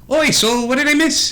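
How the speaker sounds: high-pitched, jaunty character voice; cheery arriving rider. Acted. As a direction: breeze in with a high, chirpy greeting. Rider Terry arrives and greets group.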